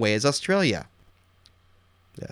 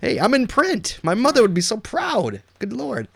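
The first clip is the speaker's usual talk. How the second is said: excited; 9 semitones higher, 3 dB louder, slower than usual. Real, not acted. Seeing himself quoted in magazine.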